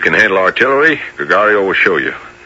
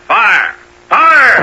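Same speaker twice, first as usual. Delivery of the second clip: a shouted order that builds louder and higher on the second Fire!; battle command shout; 13 semitones higher, 5 dB louder than usual. Acted.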